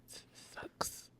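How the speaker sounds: barely voiced, whispered admission; resigned whisper. Real, not acted. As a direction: let it out under your breath. Sums up her painful feelings.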